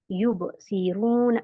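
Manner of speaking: युबसिरूना slow, light bounce on युब; slight jolt. Shown as a demonstration. Teacher showing a light bounce. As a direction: say युबसिरूना slowly with a gentle ब kick. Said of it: "हल्का सा यहाँ झटका दिया जाता है".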